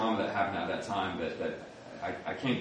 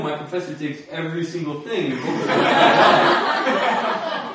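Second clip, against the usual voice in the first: normal talking voice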